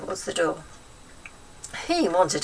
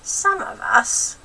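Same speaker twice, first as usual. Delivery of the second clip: high, slow breathy whisper; throaty whisper; 13 semitones higher, 4 dB louder, slower than usual. A narrator's reading. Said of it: "whispering in a throaty sort of voice".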